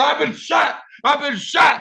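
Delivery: high, strained cry of a man just shot; high, stumbling shout of the wounded man; panicked wounded man. Retold or imitated. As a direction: cry out in a panic that you're hit. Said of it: "crackhead started stumbling, talking about".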